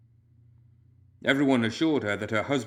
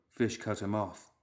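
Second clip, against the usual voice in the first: ordinary delivery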